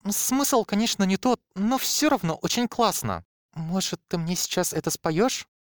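high, bubbly flirting. A narrator's reading. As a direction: bat your eyelashes and ask. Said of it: "Захлопала ресницами блондинка".

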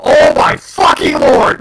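real disbelief shouted out; drawn-out furious cry; exasperated yell. Real, not acted. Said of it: "I'm so pissed off".

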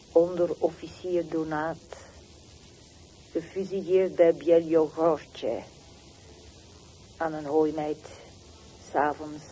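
loud, hard announcement of his death; loud declaration. Acted. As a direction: proclaim it loudly. Reading sender, execution place loudly.

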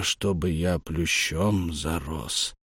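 put-on slow, soft frail old voice; very old man. A narrator's reading. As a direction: murmur it like a dazed old man. Старик повторяет слова. (дряхлый старик, медленно и тихо)

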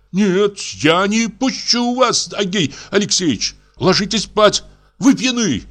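high, slow, firm refusal; doctor blocking him. A narrator's reading. Doctor barring drunk investigator's way, high firm. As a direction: stand in the doorway and refuse.

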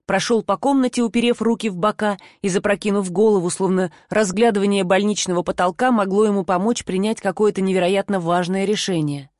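plain reading voice

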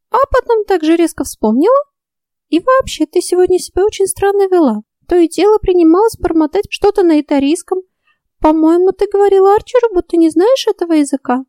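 high, prying little fairy; suspicious fairy voice. A narrator's reading. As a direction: question her like a nosy fairy.

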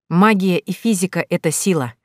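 usual speaking voice

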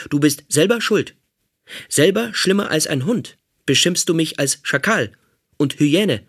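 a hint of a self-righteous robot guard; robot captor. Robot captor threatening the prisoner, lightly menacing voice.

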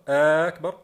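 أكبر said loud as "aakbar", first vowel held; stretched hamza. Shown as a demonstration. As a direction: hold the opening vowel of أكبر. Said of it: "الخطأ الأول هو مد همزة أكبر". (أكبر بمدّ الهمزة في أوله: آكبر)

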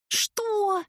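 high outraged squeak; shocked at the tip for milk; indignant surprise. Acted. Возмущённое удивление героини. (возмущённое «Что?»)

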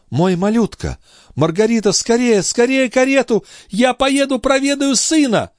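very high, frantic calling; panicked mother. Acted. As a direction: cry out urgently for help, voice shooting right up. Mother panics, calls for carriage. (отчаянный, очень высокий материнский крик)

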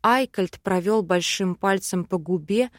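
ordinary delivery, nothing marked

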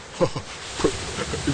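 laugh. Acted. Lambiek cackles at own joke.

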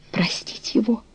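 soft, breathy whisper in wonder; a hushed, incredulous whisper; astonished whisper. A narrator's reading. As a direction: whisper it to yourself in disbelief. She can't believe he asks.

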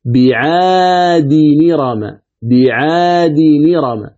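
"بعاد نرم" very slowly as bi-'aadi-ni-rama; slow model of "بعاد نرم", said twice; linked reading demo. Shown as a demonstration. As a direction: stretch the long vowels, no hamza in "بعاد نرم". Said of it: "فتقرأ الكلمة".

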